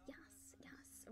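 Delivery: soft unvoiced yes; whisper. Whispering congrats to the winner. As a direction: breathe it out as a whisper.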